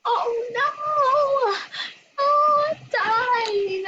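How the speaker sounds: high, loud laughing yell; laughing shout. Real, not acted. Player watching herself die in the game, laughing shout. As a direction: shriek through laughter at dying.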